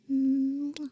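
loud smacking air kiss; kiss sound. Acted. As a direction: blow a kiss into the mic. Kiss sound while holding listener.

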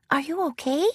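soft, high, gentle question; lightly voiced shy pony, quiet and concerned; timid concern. A narrator's reading. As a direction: ask it softly and timidly. Shy pony asks crying friend.

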